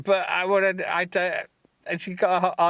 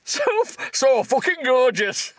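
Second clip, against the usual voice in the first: mock swoon; 11 semitones higher, 4 dB louder than usual. Mocking the hero's line.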